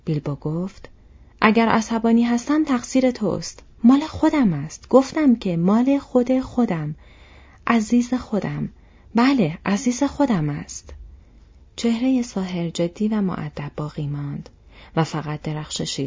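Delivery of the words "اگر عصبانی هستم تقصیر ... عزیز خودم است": loud, high, heated retort; heated possessive outburst; angry, possessive. Acted. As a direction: lash back and jealously claim it as yours. Bilbo quarrels angrily, claiming the ring.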